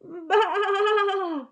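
high, loud lamb bleat; frightened lamb calling out high; lamb bleating loudly. Acted. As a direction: bleat out like a lost little lamb. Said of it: "he heard in the distance".

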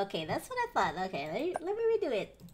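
ordinary talk, nothing marked

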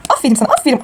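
normal talking voice

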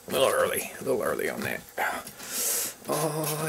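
normal talking voice

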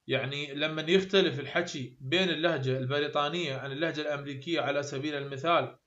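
normal talking voice

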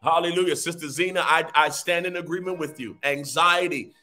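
fervent prophetic decree over music; fiery preacher declaring over the people; preaching. Acted.

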